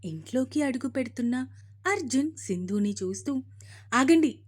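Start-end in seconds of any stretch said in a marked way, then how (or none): 3.9-4.4 loud commanding "stop!"; mother-in-law shouting, much louder; sudden shout. A narrator's reading. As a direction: bark it out to halt them at the door. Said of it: "ఒక్కసారిగా గట్టిగా అరిచింది".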